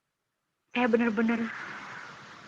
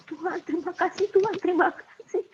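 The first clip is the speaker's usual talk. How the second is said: high, rising cries of thanks to God; joyful thanks; 6 semitones higher than usual. Retold or imitated. Mother thanks God when her child's fever breaks, rising joyful cries. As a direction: leap for joy with gratitude. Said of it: "saya loncat ke girangan".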